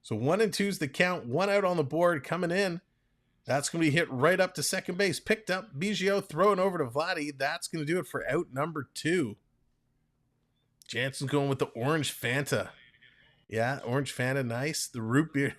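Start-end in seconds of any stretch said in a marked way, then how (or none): 0.0-9.4 brisk baseball call, a bit higher; play-by-play. Acted. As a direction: call the pitches like a radio announcer.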